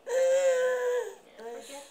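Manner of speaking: hammed-up wail, high and loud; mock crying. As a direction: fake a sob to tease the baby. Mother teases with mock crying.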